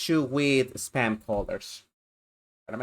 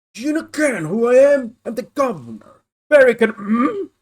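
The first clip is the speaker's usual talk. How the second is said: higher, louder self-important official; pompous governor; 7 semitones higher, 10 dB louder than usual. Acted. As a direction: puff up as the proud governor. Voices the prison governor.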